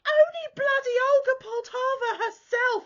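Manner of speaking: high, loud, proud announcement; excited shouted reveal; triumphant shout. Retold or imitated. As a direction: boast it at full volume. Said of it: "And I'm like".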